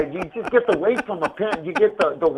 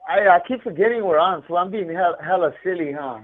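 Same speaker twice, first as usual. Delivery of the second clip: normal talking voice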